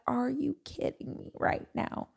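high, soft, whispery disbelief; a little peeved. Retold or imitated. Bumped from an early flight, a little peeved, incredulous whisper. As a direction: whisper it like you can't believe this. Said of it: "So I was a little peeved".

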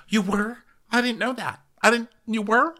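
high, wide-eyed disbelief; mock surprise. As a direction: react as if just finding out, pitched high. Acting out Mary's surprised reply.

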